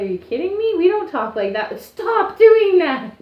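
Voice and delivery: high exasperated protest, building loud; mock outrage. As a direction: drag out the final plea in comic indignation. Acts outrage at stereotyped accent.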